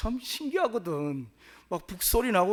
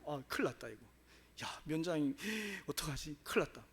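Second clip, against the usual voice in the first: softer, fretful muttering to himself; panicked mutter; 6 semitones lower, 9 dB quieter than usual. Retold or imitated. Husband realising he beat the mayor's wife, quiet panic. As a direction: mutter it like a man who knows he's in trouble. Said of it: "덜덜 떨고 있는데".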